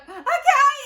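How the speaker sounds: very high, loud yelp of obedience; panicked squeal. Retold or imitated. Scared child obeying Nan's order to run, panicked squeal. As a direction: squeal it in mock terror.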